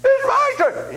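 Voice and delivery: put-on high, slow shouted child's demand; whiny child. Selfish kid demanding his toy from the parent, high whiny yell.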